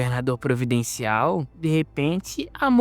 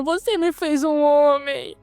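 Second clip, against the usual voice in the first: grateful shout; 12 semitones higher than usual. Acted. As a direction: shout it while hugging him.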